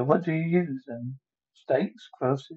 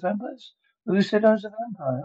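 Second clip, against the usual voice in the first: high, indignant surprise; startled disbelief; 7 semitones higher than usual. Acted. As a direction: throw the word back, higher. Accused of being a vampire.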